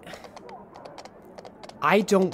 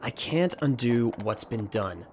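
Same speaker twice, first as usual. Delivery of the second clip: ordinary talk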